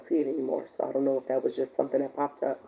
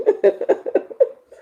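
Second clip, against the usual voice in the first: a burst of high breathless laughter; high airy laugh; 7 dB louder than usual. Real, not acted. Host laughing at herself and TikTok glitching, high airy laugh. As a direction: let a big laugh out.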